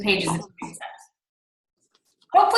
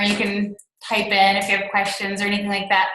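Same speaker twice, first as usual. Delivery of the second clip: ordinary talk, nothing marked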